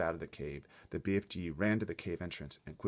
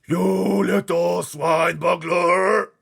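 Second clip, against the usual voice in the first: high, very loud, slow furious roar; 12 semitones higher, 17 dB louder, much slower than usual. Acted. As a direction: roar the insult at the other giant. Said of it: "roared the B F G".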